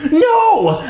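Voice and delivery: very high-pitched cry of horror; long, high acted scream; panicked shriek. A narrator's reading. Acted panic saving the creature.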